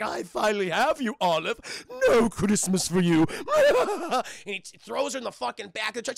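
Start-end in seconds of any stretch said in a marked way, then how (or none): 0.0-3.3 loud, gloating cartoon villain; hammed-up kidnapper's threat; villainous postman. 3.3-4.4 loud, high villain cackle; hammed-up gloating laugh; evil laugh. Villain postman's laugh after threat.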